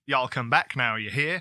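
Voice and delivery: Welsh speaker hamming up a Southern drawl; mock Southern drawl. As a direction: drawl it like a KFC server from the South. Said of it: "southern drawl".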